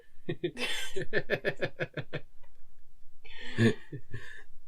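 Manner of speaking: breathy giggling fit at her own muddle; embarrassed laugh. Real, not acted. Cohost laughing after failing to explain. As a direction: laugh it off, long and airy. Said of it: "Not quite".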